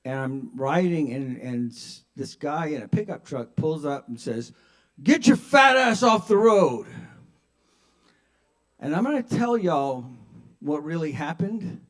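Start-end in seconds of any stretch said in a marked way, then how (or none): 5.0-6.9 loud, raised heckle; driver's angry yell. Acted. Pickup driver to a cyclist, angry shouting. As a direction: holler it at a cyclist.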